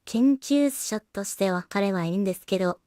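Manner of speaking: usual speaking voice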